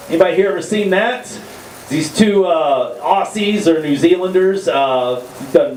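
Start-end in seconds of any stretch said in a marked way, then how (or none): none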